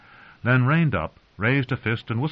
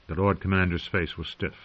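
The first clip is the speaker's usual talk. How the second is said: plain reading voice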